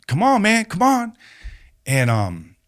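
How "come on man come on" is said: high-pitched coaxing imitation; retold urging. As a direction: imitate someone urging him on from the sidelines. She silently urges him on stage.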